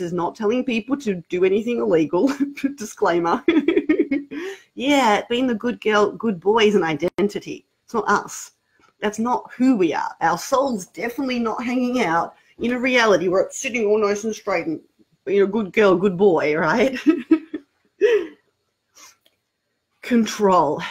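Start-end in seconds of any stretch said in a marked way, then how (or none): 3.5-4.5 high-pitched laugh; light giggle. Real, not acted. 17.0-17.6 high-pitched laugh; light giggle. Real, not acted.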